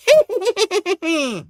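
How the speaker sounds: very high, giggling villain cackle; long hammed-up evil laugh climbing high; maniacal cackle. Goblin-like Spider-Man delighted by cruelty, maniacal cackle.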